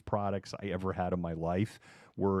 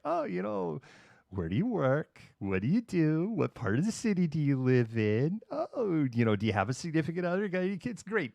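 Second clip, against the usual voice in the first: high-pitched mock politeness; passive-aggressive small talk; 8 semitones higher than usual. Seattleite quizzing a stranger at a bar, mock-polite. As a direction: imitate hollow chitchat, pitched up. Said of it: "five minutes of bus stop talk".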